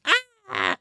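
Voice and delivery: put-on high, short baby "ah"; imitated baby cry. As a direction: mimic a baby's high little cry. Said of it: "when you were a baby".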